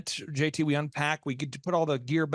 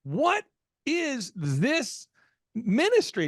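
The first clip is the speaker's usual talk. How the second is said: high, halting disbelief with heavy pauses; exasperated questioning; 6 semitones higher, much slower than usual. Retold or imitated. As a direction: query the wife word by word, incredulous. Acts husband questioning expenses to wife.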